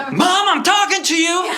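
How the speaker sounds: high, slow, demanding holler; yelling. Acted. Boy demanding his mother's attention, yelling. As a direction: yell at Mom, dragging the words out. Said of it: "why are you yelling at your mama".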